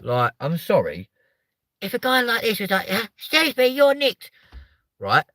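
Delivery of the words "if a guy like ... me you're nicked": very high squeaky policeman voice; squeaky copper. Retold or imitated. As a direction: squeak out an arrest. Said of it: "really high squeaky voice".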